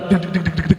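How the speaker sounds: mimicking thumping club music; imitated bass beat. Club music kicks back in.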